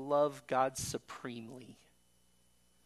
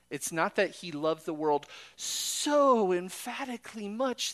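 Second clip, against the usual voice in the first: higher, louder preaching voice building up; rising preaching; 7 semitones higher, 5 dB louder than usual. Acted. Preacher driving home how God loved the world. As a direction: lift the whole passage into full preaching force.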